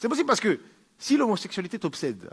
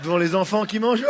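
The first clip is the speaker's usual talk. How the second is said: ordinary talk, nothing marked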